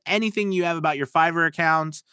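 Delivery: normal talking voice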